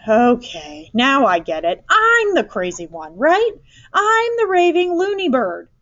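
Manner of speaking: sarcastic outburst climbing very high; exasperated groaning. A narrator's reading. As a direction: build from groaning to loud, high-pitched exasperation. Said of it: "Missus Eberhardt groaned".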